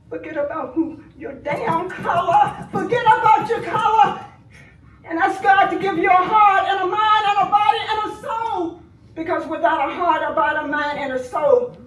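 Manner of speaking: loud, high, fervent appeal to God; impassioned preaching. Real, not acted. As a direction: preach it at them with full force. Grieving aunt preaching at killers.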